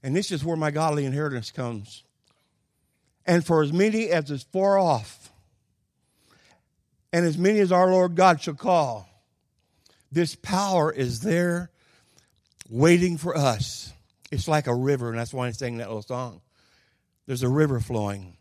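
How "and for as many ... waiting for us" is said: slow, loud, high preaching build. Acted. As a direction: proclaim it like a pulpit preacher. Preaching scripture at full force.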